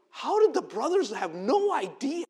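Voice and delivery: ordinary talk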